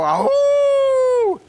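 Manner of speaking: long, high demonstration howl; wolf howl. Shown as a demonstration. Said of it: "you go".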